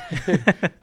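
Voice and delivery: big burst of laughter, high and loud. Real, not acted. Laughs at own podcast plug.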